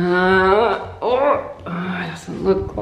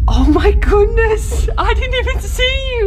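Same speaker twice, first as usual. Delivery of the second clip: high, loud shocked cry; startled shriek; 14 semitones higher, 6 dB louder than usual. Real, not acted. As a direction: jump at a sudden discovery. Mum startled finding a kid.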